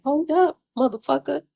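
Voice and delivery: imitated shocked pushback at a liar; mock outraged hold-up; indignant disbelief. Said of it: "And you're like".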